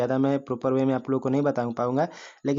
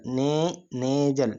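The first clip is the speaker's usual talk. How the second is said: ने held long, then nasal; stress on ने; much slower than usual. Shown as a demonstration. Teacher showing the first syllable, ने stretched out. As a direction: lengthen ने in nasal. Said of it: "ने पे एक मिल रहा है".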